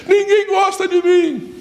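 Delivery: high-pitched, drawn-out mock wail; hammed-up high cry of complaint; imitated wailing complaint. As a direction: wail it slowly, mimicking a self-pitying son. Imitates hidden son calling out.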